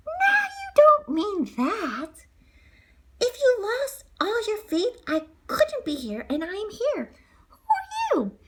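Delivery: put-on high, slow sweet old lady voice; fairy godmother comforting the crying girl. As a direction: coax her gently like a kind old fairy.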